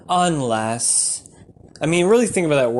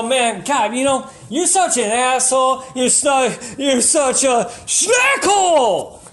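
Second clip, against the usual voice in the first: high, exasperated put-down, shouted at the end; mock exasperation; 10 semitones higher than usual. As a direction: groan out the insult, then yell the punchline. Acted insult quote.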